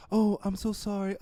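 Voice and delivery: high, timid little apology; meek apologetic mom. Retold or imitated. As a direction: apologise meekly like a hurt mother. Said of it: "she's like".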